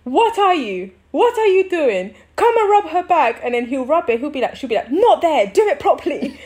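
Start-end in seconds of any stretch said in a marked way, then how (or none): 0.0-3.4 imitating a scolding, high and loud; cussing him out. Partner scolding the husband to rub her back, mock angry, high and loud. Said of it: "she just kept cussing him". 4.9-6.4 mock scolding, high and loud with a laugh; laughing while barking a correction. Partner snapping at the husband rubbing her back, mock angry.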